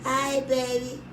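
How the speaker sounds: greets him sweetly as if she really picked up; sweet phone greeting. Retold or imitated. Mimicking her recorded greeting to the husband, lightly sweet. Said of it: "I left the answering machine, say".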